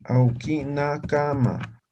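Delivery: Aoki Nakama drawn out slowly with stress on Aoki and a falling end; slow model pronunciation. Shown as a demonstration. Teacher answers a student by modelling the phrase slowly. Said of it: "Puedes decir".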